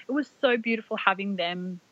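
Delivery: usual speaking voice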